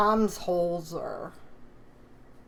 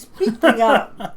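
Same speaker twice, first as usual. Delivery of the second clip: drawn-out shout, pitched high; loud, slow, raised-pitch call; loud relaying call; 4 semitones higher, 15 dB louder, slower than usual. Real, not acted. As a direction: holler it slowly across to someone. Relaying loudly during sound trouble.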